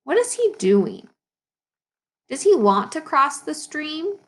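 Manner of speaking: ordinary talk, nothing marked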